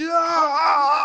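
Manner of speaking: loud, high yell for a spinning punch; mock battle cry. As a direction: let out a wild cartoon scream.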